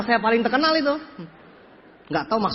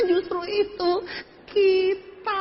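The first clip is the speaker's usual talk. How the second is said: high, slow, softer whimper; mock whimpering answer; 8 semitones higher, 5 dB quieter, much slower than usual. Speaker admitting no local success, high mock whimper. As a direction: squeeze it out in a half-crying mock wail.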